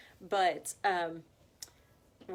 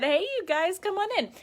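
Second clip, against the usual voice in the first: excited greeting; 10 semitones higher, 10 dB louder than usual. Real, not acted. Greeting arriving live viewers.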